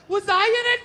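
high-pitched wife voice; imitated wife. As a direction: mimic a wife asking about herself. Wife's question voiced.

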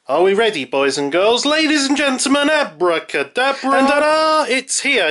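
high, showy magician's reveal; kids party magician. Pretend. Mock magic trick reveal.

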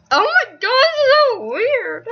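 high, loud and drawn out, weirded out. Real, not acted. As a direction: cry out high and slow at the strangeness. Startled by odd webcam view.

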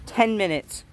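usual speaking voice

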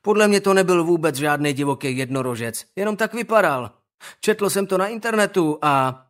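put-on smug boy voice; cocky schoolboy. Owen argues with the teacher, smug.

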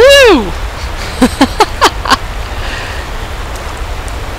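high whoop breaking into laughter; laughing. Real, not acted. Gamer cheers on the dancing box, whooping laughter. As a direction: let a high squeal tumble into giggles.

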